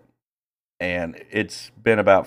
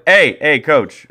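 raised, higher voice hailing someone; retold calling out; 6 semitones higher, 6 dB louder, slower than usual. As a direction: call over to the coach. Umpire calls out to coach.

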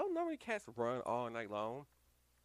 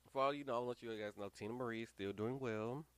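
usual speaking voice

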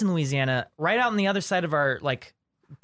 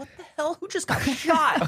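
much higher, alarmed bystanders blurting out; startled library crowd; 9 semitones higher than usual. Retold or imitated. Library crowd reacting to his yell, high alarmed outburst. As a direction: play the whole room jolted by a noise. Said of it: "hundreds of people in this huge room are all like".

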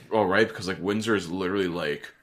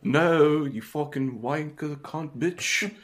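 slow laughing fake Cockney cursing; half-laughing slow Cockney swearing; mock Cockney insult; 7 semitones higher, much slower than usual. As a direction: drag out the insults in a put-on Cockney. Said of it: "sound like Mary Poppins".

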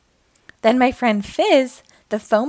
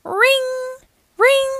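high, sustained singsong ring; imitated alarm bell; 13 semitones higher than usual. A narrator's reading. Firehouse alarm calls the trucks out, high ringing imitation. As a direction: hold each ring on one high note.

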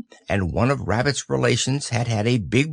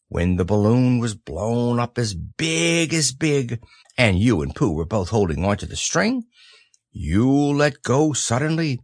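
ordinary delivery

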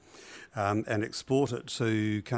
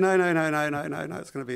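higher, louder run of emphatic no's; a retold protest, pushed higher and louder; insistent retold no; 7 semitones higher, 6 dB louder than usual. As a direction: brush off the doubt firmly. Retorts to a dismissive friend.

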